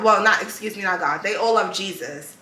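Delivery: ordinary talk, nothing marked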